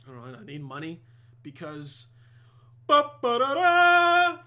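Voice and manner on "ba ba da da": loud, high trumpet-style fanfare; drawn-out triumphant ta-da flourish; sung fanfare. Real, not acted. Host revealing his new computer, triumphant mock fanfare.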